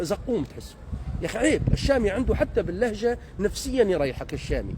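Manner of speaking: ordinary talk, nothing marked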